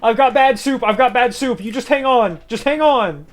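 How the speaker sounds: high, loud urgent call; mock urgent. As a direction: cry out like a rescuer. Rushing to save teammate.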